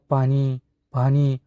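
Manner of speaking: slow, faint begging for water; weak plea for water. Acted. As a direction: croak it out like a dying patient. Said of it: "असं बोलतो". (क्षीण आवाजात हळू पाणी मागणे)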